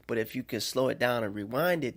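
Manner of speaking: normal talking voice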